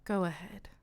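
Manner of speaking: breathy whisper, daring him to strike; whispered dare. Acted. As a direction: whisper it like a lure. Interna dares the child.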